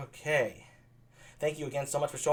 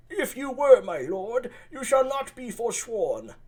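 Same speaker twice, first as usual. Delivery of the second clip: much higher, altered voice; a courtly old host; Leonato; 9 semitones higher, 5 dB louder, slower than usual. Acted. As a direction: Leonato to the prince, higher and slow. Leonato's lines.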